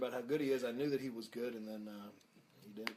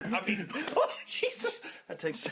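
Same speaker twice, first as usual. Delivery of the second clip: laughing; 6 semitones higher, 4 dB louder, slower than usual. Real, not acted. Laughing fit.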